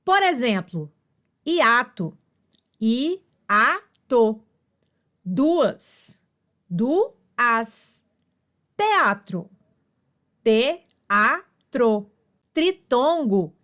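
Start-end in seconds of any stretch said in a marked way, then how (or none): 2.8-4.4 "i-a-to" said syllable by syllable; sílabas separadas. Shown as a demonstration. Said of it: "duas vogais em sílabas separadas". 6.7-8.0 "duas" broken in two, du-as; sílabas separadas. Shown as a demonstration. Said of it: "duas vogais em sílabas separadas". 10.4-12.1 "te-a-tro" said syllable by syllable; sílabas separadas. Shown as a demonstration. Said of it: "duas vogais em sílabas separadas".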